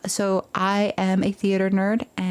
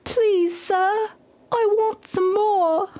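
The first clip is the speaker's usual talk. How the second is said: put-on much higher small pleading child, slow; Oliver Twist; 12 semitones higher, slower than usual. Shown as a demonstration. The orphan pleading with the overseer, childlike begging. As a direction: beg meekly like an orphan. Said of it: "holding the bowl up and going".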